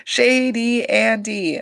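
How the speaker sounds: mock accusation, drawn out; slow drawn-out tease. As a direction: stretch out Shady Andy, slowly and pointedly.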